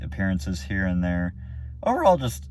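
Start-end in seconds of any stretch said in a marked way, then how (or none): 1.8-2.5 voice cracking. Real, not acted. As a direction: voice cracks up high. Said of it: "I just voice cracked there".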